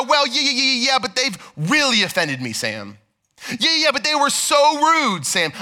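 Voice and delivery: high mock whine of a congregant dodging; whiny excuse-maker. Congregant making excuses to pastor Sam, mock whiny. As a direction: play the reluctant churchgoer pleading with Sam. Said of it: "It's not a".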